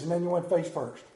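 ordinary talk

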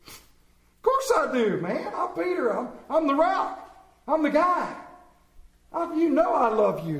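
high, loud, cocky protest; brash Peter. Acted. Peter assures Jesus of his love, brash and loud. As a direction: boast it with hammed-up swagger. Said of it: "He's all brash".

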